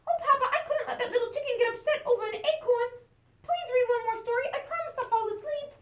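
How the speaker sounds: high, eager little chick voice; little chicken voice. Acted. Chick asking her papa for a bedtime story, high and eager. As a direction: squeak it up like a small excited chick.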